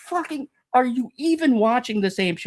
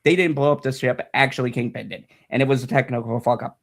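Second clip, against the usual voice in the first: much lower, smug mock apology; mock critic apology; 13 semitones lower than usual. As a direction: parody the apology that never came. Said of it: "came out and said".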